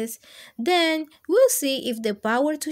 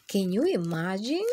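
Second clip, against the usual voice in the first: usual speaking voice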